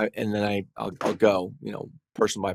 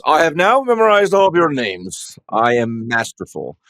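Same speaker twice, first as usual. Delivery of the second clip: higher, louder, slow and grandiose; pompous announcement; 5 semitones higher, 7 dB louder, slower than usual. Pretend. Lawyer mocks bragging to the jury, grandly pompous. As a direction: boast like a self-important showman.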